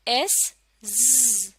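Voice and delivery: एस, ज़ said very slowly; slow sound demo; slow z blend. Shown as a demonstration. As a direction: draw the ज़ out. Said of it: "आप भी पढ़िए".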